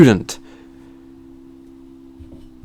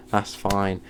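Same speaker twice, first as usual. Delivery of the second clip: ordinary talk, nothing marked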